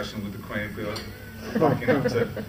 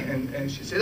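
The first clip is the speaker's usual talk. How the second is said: ordinary talk, nothing marked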